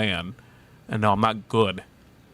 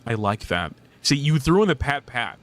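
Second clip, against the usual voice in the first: ordinary delivery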